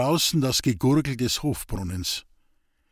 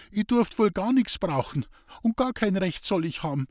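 put-on higher, mildly aggrieved girl; hurt protest; 7 semitones higher than usual. A narrator's reading. Girl feels passed over by Roman, hurt protest.

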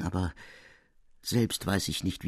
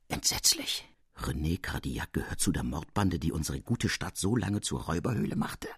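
hushed, horrified whisper of shock; 6 semitones lower, 6 dB quieter than usual. A narrator's reading. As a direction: breathe it out in horror. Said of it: "von Grausen erfüllt".

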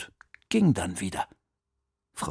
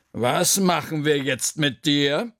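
slow, higher, drawn-out pondering; pondering aloud; 4 semitones higher, 3 dB louder, slower than usual. A narrator's reading. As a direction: draw it out as you weigh the punishment. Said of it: "überlegte Frau Krüger laut". (langsam und gedehnt laut überlegend)

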